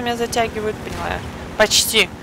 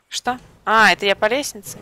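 usual speaking voice

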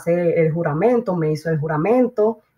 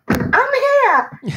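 high, eager call of I'm here. Retold or imitated. Answering the officer from the far end of the room, high eager call. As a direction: sing out your presence from far away.

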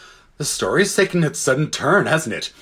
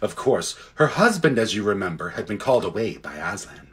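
ordinary delivery, nothing marked

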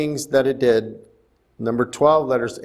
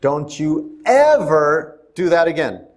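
"ever" punched hard, a mock scolding; emphasis on ever; 4 dB louder than usual. Shown as a demonstration. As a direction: hit "ever" like a scolding parent. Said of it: "we want to place emphasis on that word".

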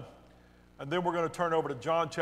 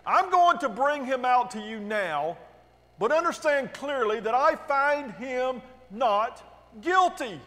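higher, emphatic public announcement; ringing official declaration; Pilate's proclamation; 6 semitones higher than usual. A narrator's reading. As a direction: address the crowd firmly with raised pitch. Pilate addressing the crowd.

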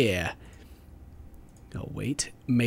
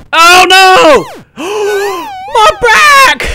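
high, loud cartoonish wail with a gasp; mock horror; 12 dB louder, much slower than usual. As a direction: cry out in hammed-up agony. Mishap in game, mock horror.

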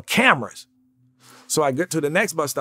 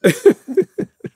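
loud, high real laugh; high burst of laughter; 7 dB louder than usual. Real, not acted. Laughing at how little the check was, loud high laugh. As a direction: break into a big real laugh. Laughs at his own story.

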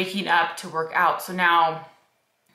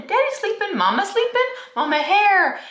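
put-on very high babbling toddler voice; imitating toddler son; 13 semitones higher, 3 dB louder than usual. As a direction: chirp it like a wide-awake little boy. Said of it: "then he goes".

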